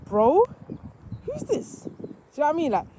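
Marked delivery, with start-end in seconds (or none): 0.1-1.8 suspicious dog voice jumping much higher on who's this; voicing the big dogs. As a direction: play the big dogs eyeing the newcomer. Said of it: "these guys are like".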